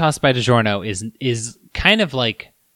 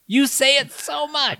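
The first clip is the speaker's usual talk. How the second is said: much higher, slow, odd voice; silly put-on voice; 13 semitones higher, slower than usual. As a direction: stretch it out in a goofy high voice. Repeated in a put-on voice.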